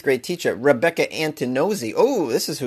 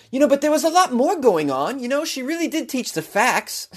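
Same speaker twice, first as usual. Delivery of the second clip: high mocking whine; shrill overlapping reality fight; 11 semitones higher than usual. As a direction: whine it like a defensive excuse. Mocking the defense.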